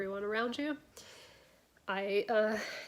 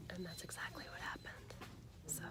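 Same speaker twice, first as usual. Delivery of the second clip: hushed, half-whispered and excited; whispering; 2 semitones lower, 11 dB quieter than usual. Real, not acted. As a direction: drop into a whisper for no reason. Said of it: "I don't know why I'm whispering".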